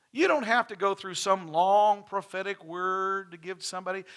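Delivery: loud, ringing sermon voice; full preaching. Acted. Pastor preaching to his church about giving, loud and ringing. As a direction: preach it out to the whole congregation.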